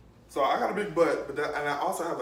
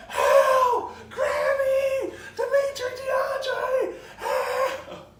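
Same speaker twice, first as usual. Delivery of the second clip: much higher, louder hammed-up screams; screaming for help; 7 dB louder, much slower than usual. A kid being whooped calling the family, over-the-top screaming. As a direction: yell for family like you're dying. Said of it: "I'm screaming at the top of my lungs".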